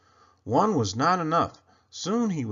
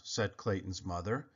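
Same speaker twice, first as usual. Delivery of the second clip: ordinary delivery, nothing marked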